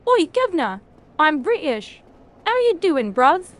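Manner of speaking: a jokey fake London accent; mock British accent. Streamer mocking a British chatter with a hammed-up cockney accent. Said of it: "I'm British too".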